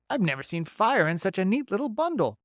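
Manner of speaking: bright, folksy beaver. Acted.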